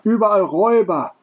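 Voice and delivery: high, lamenting stage groan. A narrator's reading. Innkeeper on stage lamenting robbers, groaning. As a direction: lift it high and wail the complaint. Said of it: "stöhnte Jojo weiter".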